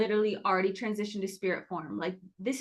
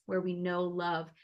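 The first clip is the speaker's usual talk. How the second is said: ordinary talk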